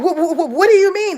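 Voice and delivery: high, flustered mock stutter. Mimicking people told no, stuttering. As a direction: stammer in shocked disbelief, imitating them. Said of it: "They gonna goddamn start stuttering and shit".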